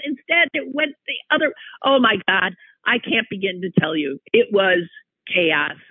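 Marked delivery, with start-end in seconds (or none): none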